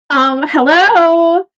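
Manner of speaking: acted hesitant call for attention; tentative greeting. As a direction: stretch the hello into an unsure question. Player's character approaches magician tentatively.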